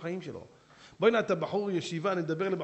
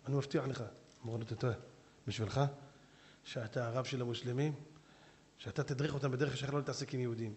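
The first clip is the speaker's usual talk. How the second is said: lower, softer measured speech; calm rabbi; 4 semitones lower, 7 dB quieter than usual. Acted. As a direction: speak gently but with authority. Rabbi speaks calmly to sheikh.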